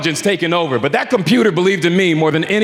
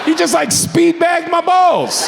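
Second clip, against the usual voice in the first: incredulous; 11 semitones higher than usual. Real, not acted. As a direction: tell it like you still can't believe it. Incredulous retelling.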